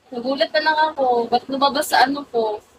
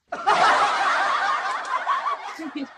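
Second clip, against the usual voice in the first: high laugh. Real, not acted. Laughing at own joke.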